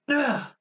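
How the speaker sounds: high-pitched exasperated "ugh"; short, high groan of annoyance; frustrated groan. Acted. Page missing from the book.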